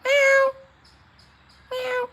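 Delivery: high imitation cat meow. Answering the stray cat with a high mock meow.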